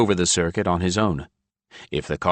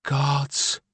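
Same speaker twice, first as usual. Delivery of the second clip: groaned; 8 semitones higher than usual. A narrator's reading. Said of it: "Ilya groaned".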